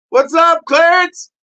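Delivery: high, loud greeting; excited greeting. Real, not acted. Host greeting viewer Clarence high and loud. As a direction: call out to him happily.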